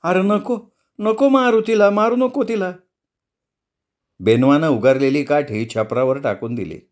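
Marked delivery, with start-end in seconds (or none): 0.0-2.7 much higher, pleading cry to stop; choked cry. Acted. Amma begs Benva not to beat the dog, high choked cry. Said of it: "घुसमटत ओरडली".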